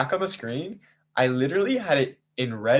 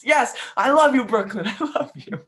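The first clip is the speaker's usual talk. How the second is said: high, loud ecstatic squeal with laughter; 13 semitones higher, 5 dB louder than usual. Retold or imitated. Host imitating Demonica losing it with joy, squealing high. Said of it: "Demonica loses it".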